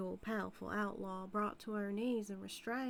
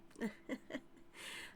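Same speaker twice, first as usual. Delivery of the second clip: breathy laugh; 7 dB quieter than usual. Acted. Amused at sheriff.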